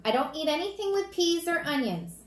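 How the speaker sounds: put-on high-pitched kid voice; fussy child. Daniel reminding Mom about supper, fussily. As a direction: insist on it like a picky little boy.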